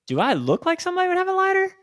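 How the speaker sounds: high, loud mock disbelief; incredulous sarcasm. To a stranger wanting a lighter, high incredulous sarcasm. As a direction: make it sound absurd he'd ask you.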